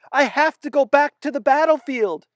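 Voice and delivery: high, loud desperate insistence; frantic. Acted. Feverish boy insisting he must go back, frantic high loud. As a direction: beg to return, panicking. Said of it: "He sounded frantic".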